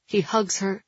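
ordinary delivery

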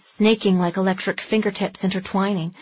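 usual speaking voice

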